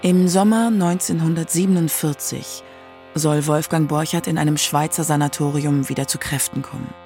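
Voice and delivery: ordinary delivery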